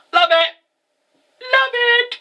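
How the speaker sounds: high squeal of love; gushing delight. Retold or imitated. Fan overwhelmed by a fast-paced episode, gushing.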